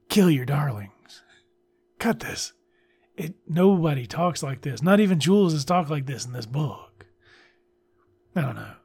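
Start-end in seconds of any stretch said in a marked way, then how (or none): none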